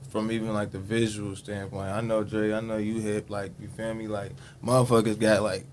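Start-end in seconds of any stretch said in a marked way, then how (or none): none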